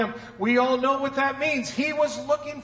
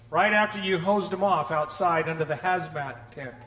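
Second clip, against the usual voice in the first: usual speaking voice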